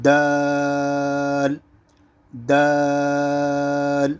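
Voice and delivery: دال with the long vowel held very slowly on one note; drawn-out دال. Shown as a demonstration. Teacher showing the letter, دال stretched long and level. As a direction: stretch دال, tongue behind the front teeth. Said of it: "کھینچ کر پڑھنا".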